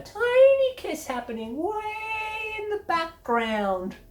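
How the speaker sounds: high, slow sarcastic squeak on tiny; sarcastic high slow mocking. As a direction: shrink the voice to belittle the kiss.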